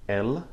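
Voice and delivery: "él" as a short, clear Spanish EL; teacher modelling "él" for the class. Shown as a demonstration. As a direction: say "él" crisply with a pure e. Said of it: "For he, it is".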